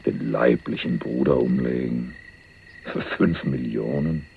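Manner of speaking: low, slow and soft, weighing each word; hushed disbelief. Acted. Cellmate repeating the murder plot to himself, low and hushed. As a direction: murmur it as if it can't be true. Stunned at the fratricide plan.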